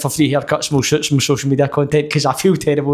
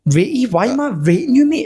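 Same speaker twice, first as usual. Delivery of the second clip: higher-pitched incredulous questioning; retold bewilderment, pitched up; confused disbelief; 6 semitones higher than usual. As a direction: act out baffled protest at a stranger. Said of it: "I was well confused".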